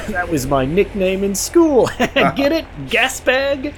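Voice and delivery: high, giggling British gentleman joke; giggly British officer. Acted. British lieutenant joking to the midshipman, high and giggling in a British accent. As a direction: laugh at your own pun, British officer. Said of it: "a very British gentleman".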